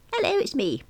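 put-on high, chatty old lady; a lightly voiced old caller; elderly caller voice. Caller voice on phone.

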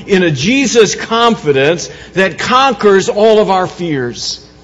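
loud, fervent pulpit preaching; rising Easter sermon fervour; preaching at full force. Acted. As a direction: drive it home to the congregation. Preaching Easter resurrection message.

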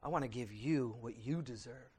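quiet, earnest vow to God; humble prayer. Retold or imitated. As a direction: pray it gently and humbly. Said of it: "we have to say".

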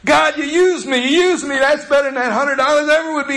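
high, loud, overjoyed praise; high excited shout of thanks to God; fired up. Retold or imitated. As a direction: burst out thanking God, thrilled. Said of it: "He was fired up".

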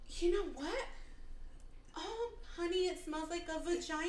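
a hammed-up customer swooning over a candle; mock dreamy buyer. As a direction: play a ditzy shopper gushing to her honey.

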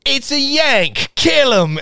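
high, drawn-out mock yell; rally the gamers against an intruder; hammed-up high British yelling; mock battle cry. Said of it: "It was like".

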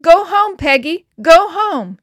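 high, loud yell to a dog; urgent, full-voiced command; shouted order. A narrator's reading. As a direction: shout it out the car window. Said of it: "Jean ordered".

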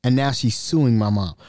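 usual speaking voice

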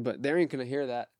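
ordinary delivery

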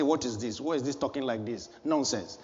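low, hushed scoffing at someone; a hushed, dismissive inner voice; quiet scornful thought. Retold or imitated. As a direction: mutter the contempt under your breath. Voicing a judgmental inner thought.

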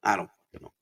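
ordinary talk, nothing marked

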